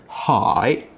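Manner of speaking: Hai drawn out, dipping then rising; isolated Hai with a full dip and rising ending; fully emphasized tone. Shown as a demonstration. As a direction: stress every part of the tone on Hai. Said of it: "emphasize all of the hai features".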